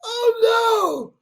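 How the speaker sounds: laughing cry. Real, not acted. Crying laugh exclamation.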